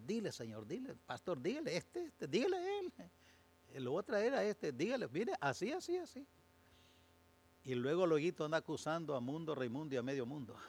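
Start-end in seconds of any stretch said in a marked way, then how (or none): 0.0-6.3 mock tattling church member, squeaking ever higher; mocking churchgoer. Preacher mimicking a gossip who tells the pastor to scold someone, climbing high mock whine. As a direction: parody a busybody pointing fingers, voice climbing high.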